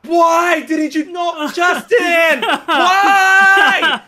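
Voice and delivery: high, loud, slow drawn-out cry, half laughing; mock anguished wail. To Justin for not tapping him, mock anguished high wail. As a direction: wail it out like a betrayed friend.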